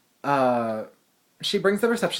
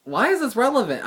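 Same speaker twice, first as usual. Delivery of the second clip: incredulous thought; 9 semitones higher, 4 dB louder than usual. Retold or imitated. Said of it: "I was thinking for a second".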